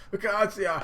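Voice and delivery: high, loud anguished call; emotional outcry. Acted. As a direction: cry the name out dramatically, in character. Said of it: "all emotional and whatnot".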